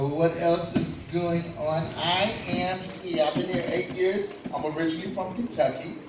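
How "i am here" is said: normal talking voice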